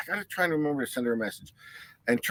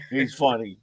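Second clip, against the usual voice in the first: ordinary talk, nothing marked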